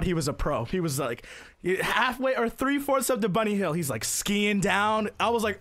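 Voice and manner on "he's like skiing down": ordinary delivery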